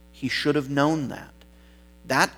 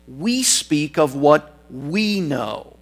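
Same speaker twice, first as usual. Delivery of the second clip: "We" leaned on, slow and weighty; stress on WE; slower than usual. Retold or imitated. Jesus answering Nicodemus, slow with the stress on "We". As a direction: hit the first "We" hard as Jesus answers. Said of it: "the emphasis there was placed on the word "we".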